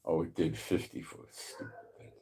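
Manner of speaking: ordinary talk, nothing marked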